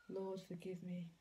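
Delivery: soft sigh, then a murmured plea to God; hushed prayer. Real, not acted. Vlogger quietly asks God for forgiveness, hushed. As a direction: breathe it out as a small private prayer.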